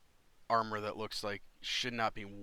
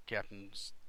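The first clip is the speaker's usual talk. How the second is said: ordinary talk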